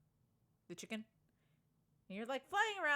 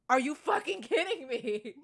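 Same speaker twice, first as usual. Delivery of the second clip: louder, furious disbelief at the broken ride; exasperated outburst; 5 semitones higher, 10 dB louder than usual. Retold or imitated. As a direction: blurt it out, fed up. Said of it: "And I'm like".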